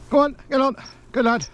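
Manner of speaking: very high, sing-song urging; high calling to dog. Real, not acted. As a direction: call out high to a distant dog.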